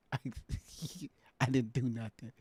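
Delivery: giggling through a quiet denial; soft, laughing protest. Real, not acted. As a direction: laugh while insisting on innocence. Laughs about restricted live.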